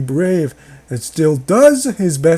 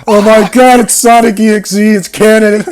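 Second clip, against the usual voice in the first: loud, higher, over-the-top fan excitement; mock excited outburst; 4 semitones higher, 8 dB louder than usual. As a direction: shout it like a huge revelation. Mock excitement at Sonic reference.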